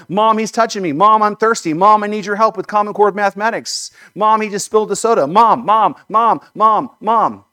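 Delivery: put-on high, loud whiny kid calling mom; whiny tattling little sibling. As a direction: nag mom over and over like a bratty kid. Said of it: "And the kids are like".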